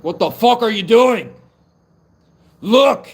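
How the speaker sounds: high, very loud yell; confrontational shout. Retold or imitated. Giant ancestor bursting into castles, shouting in confrontation. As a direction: burst in yelling at them in outrage.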